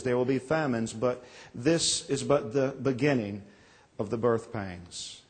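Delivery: solemn gospel reading from the pulpit; scripture reading. Acted. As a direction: read the lesson with reverence. Reads the gospel lesson aloud.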